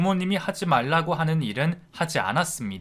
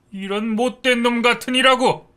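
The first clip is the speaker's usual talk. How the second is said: 같으니라고 shouted high and loud, falling at the end; furious father; 9 semitones higher, 7 dB louder than usual. A narrator's reading. Father damning his lying son, 같으니라고 yelled high and falling. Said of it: "아버지가 아주 많이 화가 나셨답니다".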